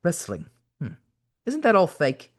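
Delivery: put-on doubtful elderly English voice; muse, then query in an old Englishman's voice; old English gentleman. A narrator's reading. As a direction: sceptical old English caller. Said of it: "The old man spoke again".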